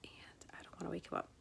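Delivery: hushed whisper, trying not to wake him. Real, not acted. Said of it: "whispering because Jose is trying to put Lucas to sleep".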